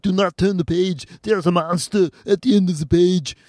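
thick mock Austrian action-hero accent; exaggerated Austrian-accented reading; Arnold Schwarzenegger. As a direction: read a children's book as an Austrian strongman. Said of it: "I started doing Schwarzenegger".

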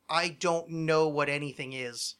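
ordinary talk, nothing marked

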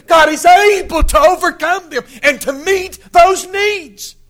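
preacher pressing the point home; preaching at full force. Acted. Preacher driving home human weakness, loud full-force preaching.

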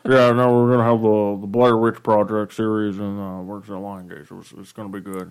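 mumbling as if marbles in mouth. Pretend. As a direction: talk through a mouth full of marbles. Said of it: "Do you have marbles in your mouth?".

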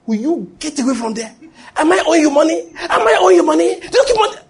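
high-pitched, rising indignant challenge; angry outburst. Retold or imitated. Pastor retelling his outburst at a begging stranger, angry. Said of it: "So I was angry".

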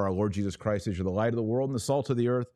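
normal talking voice

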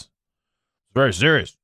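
put-on grave self-importance; mock very serious. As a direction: act as if it's gravely serious. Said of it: "make sure that everything is very serious".

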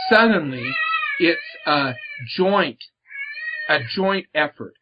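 choking up on "Suddenly", halting after it; real emotion catching in the throat, pauses between words; voice breaking. Real, not acted. Moved while sharing his faith with the host, voice breaking.

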